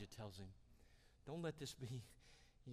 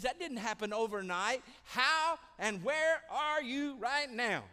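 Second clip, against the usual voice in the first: loud, high exasperated parent; parent scolding; 11 semitones higher, 12 dB louder than usual. Retold or imitated. Father sitting his kids down, loud and exasperated. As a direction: demand answers from your kids. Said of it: "I'd say".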